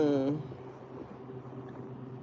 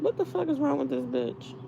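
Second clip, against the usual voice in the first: imagined onlookers; 8 semitones higher, 3 dB quieter than usual. Pretend. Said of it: "They'd probably be like".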